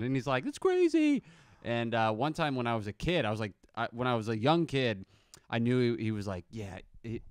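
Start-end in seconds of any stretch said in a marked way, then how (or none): 0.4-1.2 much higher put-on innocent voice; mock innocent voice. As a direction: squeak it out innocently. Dad acting innocent, funny voice.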